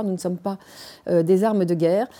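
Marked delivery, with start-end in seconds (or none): none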